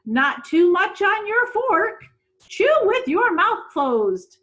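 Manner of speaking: high, loud, slow prim instruction; a fussy lady correcting manners; prim guardian. Acted. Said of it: "she would say".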